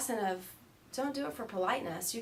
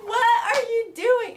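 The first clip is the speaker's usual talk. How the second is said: high, loud, drawn-out exclamation; mock outraged cry; 15 dB louder, slower than usual. Student to laughing yogi, loud high indignation. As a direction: cry out in exaggerated disbelief. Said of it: "he dies laughing".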